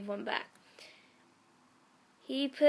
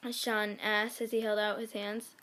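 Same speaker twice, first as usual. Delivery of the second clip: ordinary talk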